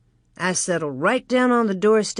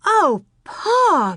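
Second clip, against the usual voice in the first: a high dismayed wail; 13 semitones higher, 5 dB louder than usual. Acted. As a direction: cry out in distress, pitched way up. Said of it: "Mary wailed".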